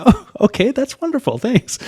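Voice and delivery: hammed-up grateful candidate; mock eager thanks. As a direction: play the keen applicant saying thanks. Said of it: "And I'm like".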